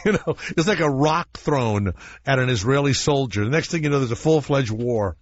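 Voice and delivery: ordinary delivery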